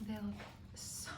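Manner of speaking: hushed whisper over food. Real, not acted. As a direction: breathe it out softly.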